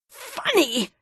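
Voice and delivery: high indignant howl; husband howling, outraged his wife laughs. Acted. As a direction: howl the word back at her in disbelief. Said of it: "he howled".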